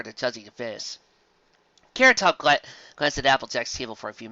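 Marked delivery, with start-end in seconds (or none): none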